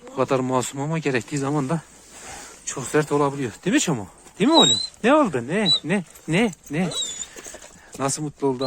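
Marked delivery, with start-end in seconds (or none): 3.6-6.9 high pet-talk coo. Real, not acted. Owner greeting his excited dog in high cooing baby talk.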